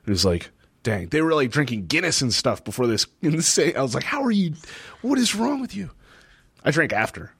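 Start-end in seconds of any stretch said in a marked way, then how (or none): 4.0-5.9 high-pitched, incredulous mock questioning; incredulous, much higher voice; retold disbelief. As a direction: act out baffled disbelief, pitched way up. Retold disbelief at others drinking.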